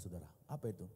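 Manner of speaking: normal talking voice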